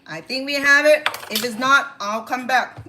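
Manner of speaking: loud call to another room. Real, not acted. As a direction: raise your voice so someone far off hears. Calling out to someone offscreen.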